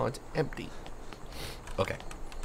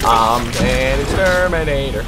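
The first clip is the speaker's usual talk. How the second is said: dramatic hero voice; 10 dB louder, slower than usual. Pretend. Boasting while killing spiders.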